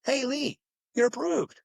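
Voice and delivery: bright clerk announcing approved; excited announcement. Retold or imitated. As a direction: sing out the good news like a salesclerk. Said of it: "and see if you're approved?".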